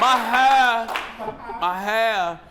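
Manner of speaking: high, loud tipsy squeal; mock the drunk caller's wail; imitating drunk guest. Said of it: "that looked like me and Addie".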